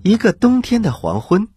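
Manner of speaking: ordinary delivery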